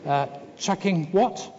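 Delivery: high, slow piglet voice. Acted. Piglet coming closer to Pooh, high and slow. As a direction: ask it as a small curious pig, drawing it out. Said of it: "said Piglet".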